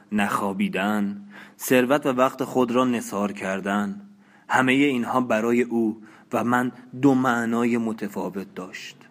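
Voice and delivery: ordinary delivery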